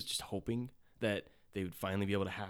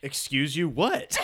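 sassy; 3 semitones higher, 7 dB louder, slower than usual. Retold or imitated. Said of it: "And she goes".

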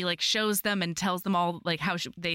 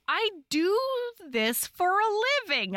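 incredulous; 11 semitones higher than usual. Real, not acted. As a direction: rant at your own slowness. Mock outrage at herself.

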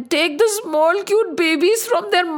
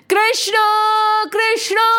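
high calling shout; shouting a name; 6 semitones higher than usual. Acted. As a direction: call his name out to the whole village. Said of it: "Every house started shouting".

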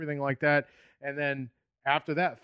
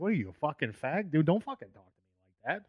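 mock Bostonian disgust; 4 semitones higher than usual. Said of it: "most Bostonians are gonna ... be like".

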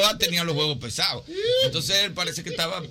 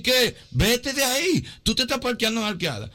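higher, urgent phone warning; alarmed warning; 8 semitones higher than usual. Retold or imitated. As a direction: warn him to get away. (esposa alarmada al teléfono, voz aguda)

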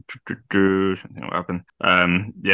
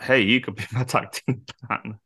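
breaking into laughter mid-quote; higher voice cracking up as you quote him; laughing retold quote; 5 semitones higher than usual. Podcaster quotes a wrestler's tag partner plea, laughing.

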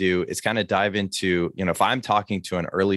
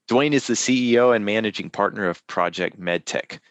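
ordinary talk, nothing marked

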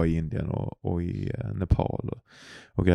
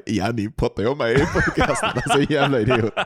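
laughing; 7 semitones higher than usual. Retold or imitated. Mocking the ex's man, laughing.

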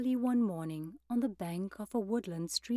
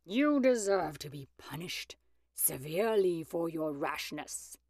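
stern wolf scolding, tailing off lower; fearless shout; 3 semitones lower than usual. A narrator's reading. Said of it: "he shouted fearlessly".

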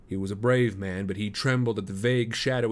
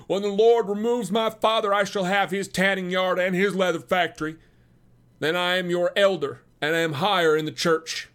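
much higher, louder competitive bragging; heated boasting; 9 semitones higher, 6 dB louder than usual. Acted. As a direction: outdo the rival, voice climbing. Rival continues heated boast.

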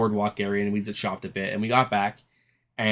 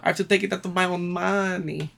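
mock owner voice, pitched much higher; whiny parody of the owner's excuse; 8 semitones higher than usual. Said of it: "the fucking owner of the hotel telling us".

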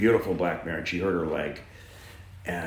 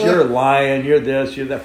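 loud, raised retold accusation; mock angry denial; 5 semitones higher, 9 dB louder than usual. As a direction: fire off accusations, imitating an outraged man. Acts out seller's angry denial.

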